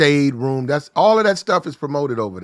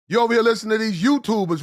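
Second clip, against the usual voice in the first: ordinary talk, nothing marked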